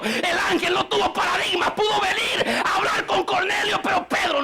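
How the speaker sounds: high, crying preacher's shout; preaching shout. Acted. Preacher crying out to the church, full force. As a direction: cry it out to the congregation.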